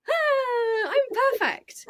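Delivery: very high, gushing voice for a dog; delighted dog's voice. Acted. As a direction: play the pleased dog, high and laughing. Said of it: "she'll be like".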